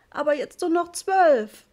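slow, high, loud sob; sobbing. A narrator's reading. Mother sobbing about the wasted money, slow high sob. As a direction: wail it through tears, slowly. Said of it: "hatte Mama geschluchzt".

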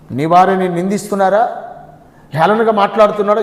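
normal talking voice

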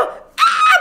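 shrieking high laughing scream; excited scream. Retold or imitated. Reliving the moment she got reposted, excited scream. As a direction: let out the fan scream.

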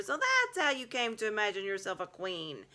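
high, loud mocking beaver voice. A narrator's reading. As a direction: sneer it out triumphantly. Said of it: "said Mister Beaver".

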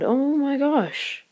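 high, slow, drawn-out disbelief; slow high amazed gasp of words; stunned amazement. Real, not acted. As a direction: stretch it out in awe. Player amazed at own play.

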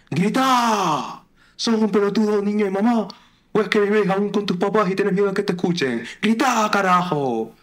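high, loud Argentine guru haranguing; shouting. Acted. Psychoanalyst goading a timid man to scream, Argentine shout. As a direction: yell it as a goading Argentine coach. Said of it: "el tipo te dice gritando".

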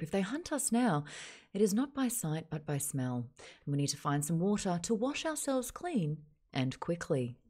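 measured Australian news read, dropping at the ends; newsreader. Shown as a demonstration. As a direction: report it like a TV newsreader. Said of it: "That's a newsreader voice in Australia".

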